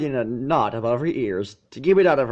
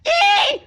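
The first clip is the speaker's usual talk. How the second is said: joyful squeal; 7 dB louder than usual. Acted. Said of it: "She squealed with her barely suppressed joy".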